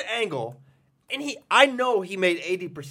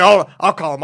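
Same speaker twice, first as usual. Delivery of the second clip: mock alarm; 12 dB louder than usual. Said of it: "So he's like".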